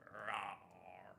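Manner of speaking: whispered playful rawr; mock growl. Streamer showing off her gym buff in a whispered rawr. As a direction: breathe a tiny flirty rawr. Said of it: "feeling a little more buff than usual".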